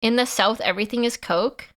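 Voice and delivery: ordinary talk, nothing marked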